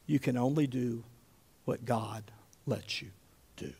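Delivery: low, very soft, measured answer; calm reply. Acted. Jesus answering Pilate's threat, quiet and in control.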